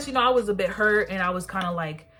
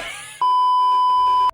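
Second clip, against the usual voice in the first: loud squealing giggle; giddy high laugh; 8 dB louder than usual. Real, not acted. Girl recalling her crush, loud high giggle.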